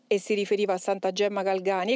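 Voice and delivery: ordinary delivery, nothing marked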